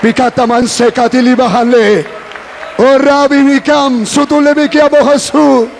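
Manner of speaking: high, drawn-out fervent chant-like prayer; praying in tongues. Real, not acted. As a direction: cry out fervently in unknown tongues. (oración ferviente en lenguas, alargada)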